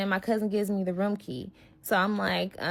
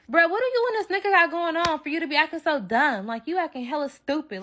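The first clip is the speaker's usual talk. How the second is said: pitched-up, mildly incredulous telling-off; retold exasperated scolding; 7 semitones higher than usual. Confronting friend over the man, exasperated. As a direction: scold her in high-pitched disbelief.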